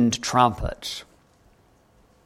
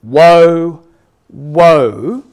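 loud, drawn-out proclamation; 7 dB louder, much slower than usual. Acted. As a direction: herald each word like an angel's warning, with pauses between. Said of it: "saying with a loud voice".

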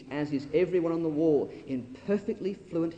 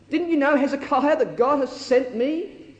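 taunting; 9 semitones higher, 6 dB louder than usual. Acted. Preacher voicing the taunting Rabshakeh.

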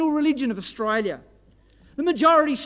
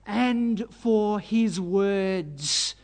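"and for his words" very slow, each word stretched; emphatic drawn-out reading; 3 semitones lower, much slower than usual. Real, not acted. Preacher underlining why the brothers hated Joseph, emphatic drawn-out reading. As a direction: lean heavily and slowly on "and for his words".

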